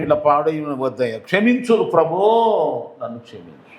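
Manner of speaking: a pleading prayer sinking into a broken near-whisper; penitent plea. Acted. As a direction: beg forgiveness, fading away. Acted sinner's penitent prayer. (క్షమాపణ వేడుకుంటూ మెల్లగా కుంగిపోయే స్వరం)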